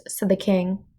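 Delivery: usual speaking voice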